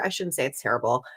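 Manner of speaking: ordinary talk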